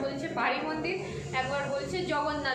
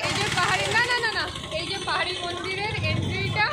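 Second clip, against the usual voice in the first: raised voice over the crowd; loud over crowd noise; 5 semitones higher, 4 dB louder than usual. Real, not acted. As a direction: speak up over a noisy crowd. Showing temple entrance amid crowd. (ভিড়ের মধ্যে জোরে বলা)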